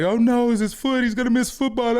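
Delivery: put-on high, loud panicky kid voice; little brother panicking. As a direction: play the worried little brother squealing about football. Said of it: "little brother was like".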